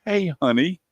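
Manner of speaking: high, innocent hey honey; mock innocent husband; sheepish greeting. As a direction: sweeten it up to dodge trouble.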